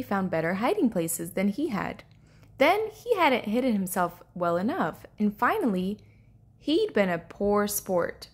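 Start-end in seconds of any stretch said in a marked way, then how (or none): none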